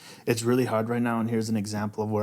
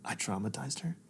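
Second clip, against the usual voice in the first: whispered and much softer, in shock; guilty whisper; 10 dB quieter, slower than usual. Retold or imitated. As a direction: breathe it out in horror at yourself. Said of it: "I was like".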